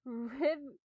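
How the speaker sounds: usual speaking voice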